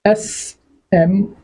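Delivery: drawn-out SMS letter by letter; slow teacher spelling; slow spelled-out letters. Shown as a demonstration. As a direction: stretch SMS slowly, each letter apart. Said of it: "क्या बोल रहे हैं?".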